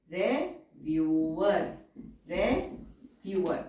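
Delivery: Reviewer stretched slowly syllable by syllable; slow and syllabled. Shown as a demonstration.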